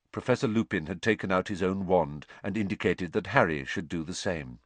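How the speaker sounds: usual speaking voice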